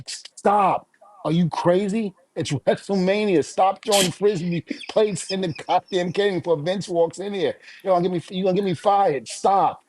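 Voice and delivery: put-on high yelling at the kids to stop; mock panicked yelling at kids; retold scream. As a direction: shout them down, half laughing. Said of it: "I'm sitting up there screaming".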